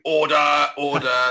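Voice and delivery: loud, drawn-out calls of order, like the Commons Speaker; mock Speaker of the House calling for order; John Bercow impression. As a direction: bellow it like the Speaker silencing the Commons. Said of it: "terrible imitations of John Bercow going".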